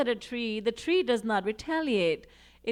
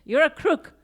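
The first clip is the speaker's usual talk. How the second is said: snapped out louder and higher, an accusing jab; mock accusation; 5 semitones higher, 8 dB louder than usual. Someone in a quarrel calling the listener a crook, in a mock angry jab. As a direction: fling the insult like an angry stranger.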